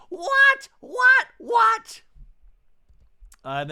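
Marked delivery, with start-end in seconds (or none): 0.1-2.0 very high, loud shout; loud stunned outcry; shocked. Real, not acted. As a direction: scream the disbelief. Astonished reaction to the rant.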